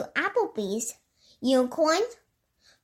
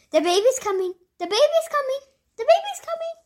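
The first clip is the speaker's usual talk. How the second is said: each repeat higher and more frantic; rising panic; 13 semitones higher, much faster than usual. Acted. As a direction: climb higher every time. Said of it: "the queen was like".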